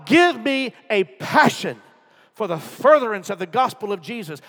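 high, loud impassioned preaching; preaching at full force. Acted. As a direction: plead with the church.

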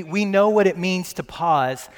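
ordinary talk

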